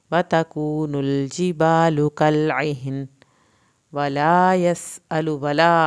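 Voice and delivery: slow, loud, drawn-out tajweed chant; Quran recitation. Shown as a demonstration. Teacher recites aloud for students, slow loud chant. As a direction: chant each word slowly for the class to follow. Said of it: "teacher models the recitation".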